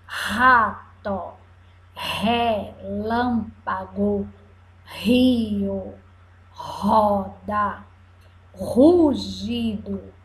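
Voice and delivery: rato, rugido with a strong throaty R. Shown as a demonstration. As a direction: hit the R at the start of each word hard. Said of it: "ele tem o som mais forte".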